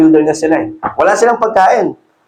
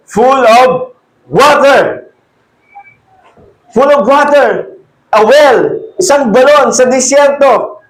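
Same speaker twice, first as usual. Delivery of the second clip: higher, slow wonder at the water; marveling; 5 semitones higher, slower than usual. Acted. Preacher marveling at a well in the desert. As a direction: sound amazed at the well.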